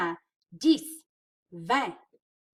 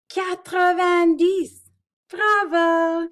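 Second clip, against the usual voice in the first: excited praise; 9 semitones higher, 12 dB louder, slower than usual. Acted. Praising child's correct answer.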